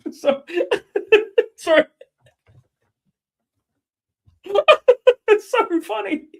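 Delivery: high, helpless laughter; can't get the words out for laughing; laughing hard. Real, not acted. Cracks up at a comment.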